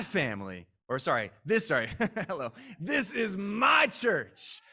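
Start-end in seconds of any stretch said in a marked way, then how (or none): 2.8-4.6 "my" stretched long, high and loud; slow, loud line punching the word "my"; stress on MY. Shown as a demonstration. Preacher shows congregation the line to repeat, stress on "my". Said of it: "say with emphasis on my".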